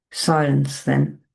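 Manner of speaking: ordinary delivery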